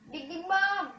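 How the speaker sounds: loud, high shouted call of the stunt; hyped catchphrase shout. Real, not acted. Gamer shouting his stunt name to viewers, loud and high. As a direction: yell the catchphrase with glee.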